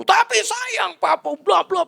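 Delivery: wife's hammed-up angry outburst. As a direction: imitate the wife ranting furiously. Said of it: "marah dia ya".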